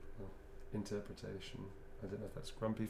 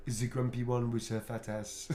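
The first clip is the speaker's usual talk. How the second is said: French accent. Shown as a demonstration. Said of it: "mangling the French accent there".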